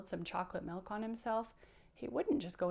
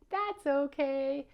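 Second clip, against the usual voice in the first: slow, higher, louder puppet catchphrase; accepting reassurance; 5 semitones higher, 6 dB louder, slower than usual. Pretend. Bouncer not crying over mistakes, slow, loud reassurance. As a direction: sing out the easygoing recovery, drawn out. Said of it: "He would say".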